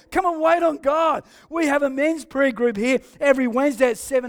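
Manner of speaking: fired-up church announcements; rally them with big energy; preaching. Real, not acted. Preaching announcements at full force.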